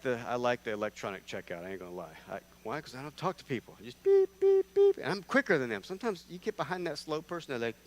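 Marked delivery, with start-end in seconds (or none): 4.0-5.0 high, loud mock beeping; scanner beep. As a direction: chirp like a checkout scanner. Mimics self-checkout scanner.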